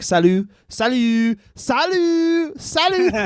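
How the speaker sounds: climbing into a squeaky French falsetto; dumb French voice. Retold or imitated. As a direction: imitate silly French gangsters, very high. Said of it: "said fucking French words in a dumb French voice".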